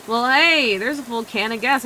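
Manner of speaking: bright, loud cheerful hello; excited greeting. Acted. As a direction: greet them like a delighted friend. NPC greets player excitedly.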